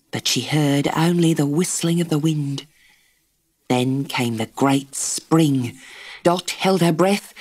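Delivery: ordinary delivery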